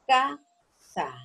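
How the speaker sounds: "Casa" broken into ca... sa, each beat held; syllable by syllable. Shown as a demonstration. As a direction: say "Casa" as two separate syllables for a class. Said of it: "dónde está la mayor fuerza".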